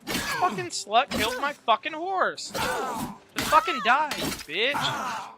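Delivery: raging at the enemy who killed his horse; furious slow cursing. Real, not acted. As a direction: spit each word out in rage.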